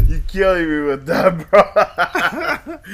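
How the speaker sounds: loud, high laughter spilling into words; can't stop laughing at a friend. Real, not acted.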